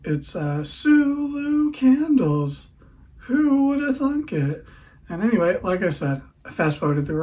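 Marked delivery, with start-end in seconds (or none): 0.6-4.6 much higher, very slow silly voice; playful mock-grand self-introduction; goofy put-on voice. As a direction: stretch your name out in a daft high voice.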